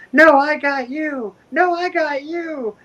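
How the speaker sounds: put-on high, slow childish whine; mimicking kids. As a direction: play a little kid insisting. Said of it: "the kids are going".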